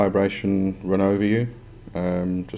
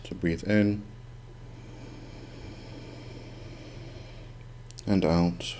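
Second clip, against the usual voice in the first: soft spa whisper; 6 dB quieter than usual. Acted. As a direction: guide the breathing gently, unhurried. Leads breathing meditation.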